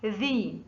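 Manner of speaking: ذي as voiced "thee", tongue tip out. Shown as a demonstration. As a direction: push the tongue between the teeth to say ذي. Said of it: "إخراج اللسان عند النطق بذي".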